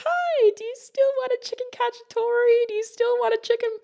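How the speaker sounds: high-pitched, doting server voice; sweet customer-service voice. Retold or imitated. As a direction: coo it at a beloved old regular.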